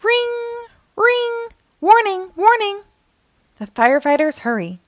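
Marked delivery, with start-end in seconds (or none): 0.0-1.5 high, sustained singsong ring; imitated alarm bell. A narrator's reading. Firehouse alarm calls the trucks out, high ringing imitation. 1.8-2.8 high, insistent repeated call; alarm warning. A narrator's reading. Firehouse alarm warns the crew, high urgent call.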